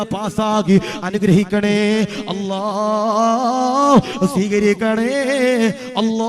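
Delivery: loud, slow, pleading prayer chant; rising loud supplication; fervent dua. Acted. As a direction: beg God's blessing for the expatriate brother.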